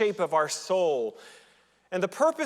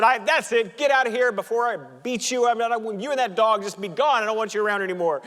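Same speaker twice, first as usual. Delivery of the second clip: higher, louder angry telling-off; fed-up bartender; 6 semitones higher, 7 dB louder, faster than usual. Retold or imitated. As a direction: throw them out, fed up. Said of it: "The bartender has had it".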